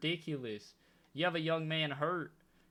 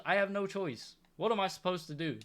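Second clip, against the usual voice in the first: usual speaking voice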